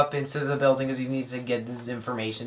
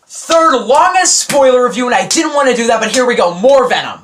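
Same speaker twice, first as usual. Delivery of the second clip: announcer shout; 8 semitones higher, 6 dB louder than usual. Real, not acted. Vlogger proclaiming his long spoiler review, shouting. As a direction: belt it like a big announcement.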